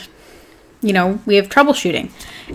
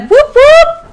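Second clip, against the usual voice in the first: high loud whoop whoop; cheer; 6 dB louder than usual. Real, not acted. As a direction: celebrate a new sign-up, high and loud. Cheering a sign-up.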